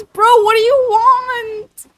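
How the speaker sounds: high, loud, stretched-out plea; exasperated wail. Real, not acted. Streamer pressed by chat for Valley Girl, exasperated wail. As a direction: wail it slowly in embarrassed protest.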